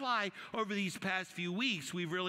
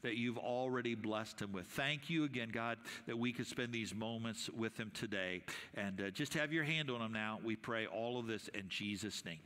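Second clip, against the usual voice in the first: prayer voice; 8 semitones lower, 5 dB quieter than usual. Acted. Closing prayer.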